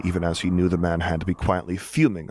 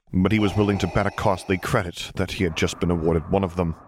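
plain reading voice

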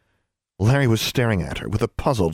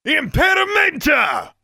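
high, drawn-out shouted spell; roared; slower than usual. A narrator's reading. Rescuer blasts the bullies with a spell, roaring. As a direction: roar the incantation slowly and fiercely. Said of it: "Maximilian roared".

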